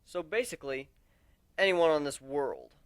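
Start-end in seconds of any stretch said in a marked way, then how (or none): none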